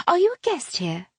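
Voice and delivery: high, pushy society chatter; gushing lady. A narrator's reading. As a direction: insist sweetly but relentlessly. Harriet voiced by narrator.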